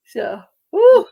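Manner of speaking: talking through a yawn. Real, not acted. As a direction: stretch it out mid-yawn. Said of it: "I'm yawning".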